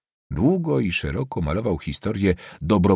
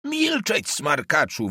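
angry yell; 8 semitones higher, slower than usual. A narrator's reading. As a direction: shriek at an insolent pupil with contained volume. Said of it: "wrzasnął nauczyciel". (gniewny, wysoki wrzask)

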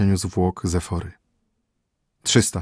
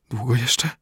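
faint whisper; 3 semitones higher than usual. A narrator's reading. Dying prince asks the physician.

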